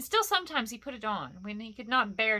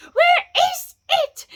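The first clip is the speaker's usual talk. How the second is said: very high, loud, slow screech; drawn-out frantic creature wail; Gollum crying out; 15 dB louder, much slower than usual. A narrator's reading. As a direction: screech it in full panic. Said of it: "Bilbo heard him crying".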